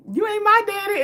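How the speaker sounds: high put-on voice from her theme; mock theme quote. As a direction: sing out the theme line high. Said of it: "her theme play of".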